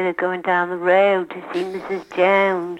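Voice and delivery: Philadelphia vowels, fronted O in road and Jones; demonstrating a Philadelphia accent. Shown as a demonstration. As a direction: say it with exaggerated Philadelphia O sounds. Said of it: "But Philadelphia would say".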